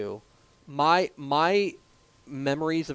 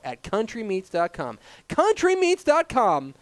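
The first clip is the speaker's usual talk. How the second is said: ad read; 6 semitones higher, 3 dB louder than usual. Acted. Sponsor ad read.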